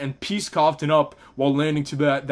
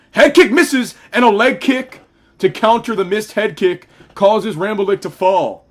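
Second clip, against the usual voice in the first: higher, louder excited play-by-play; excited call; 3 semitones higher, 6 dB louder than usual. Real, not acted. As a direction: call the big moment with energy.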